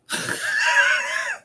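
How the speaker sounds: high burst of laughter; loud laugh. Real, not acted. After the flight attendant story, a high loud laugh.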